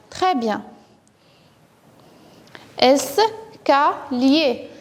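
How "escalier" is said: slow "Escalier", one syllable at a time; split into syllables. Shown as a demonstration. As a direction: separate "Escalier" so the final syllable with L stands out. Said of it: "C'est là qu'on entend le son L". (« Escalier » très lentement, syllabe par syllabe)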